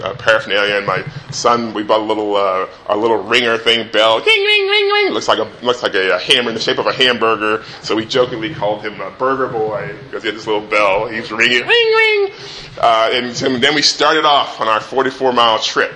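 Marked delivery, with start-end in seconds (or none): 3.9-5.1 high sing-song ring ring of a bike bell; playful bell noise, very high; bell ding imitation. 11.7-12.3 high sing-song ring ring of a bike bell; playful bell noise, very high; bell ding imitation. Son ringing the bell.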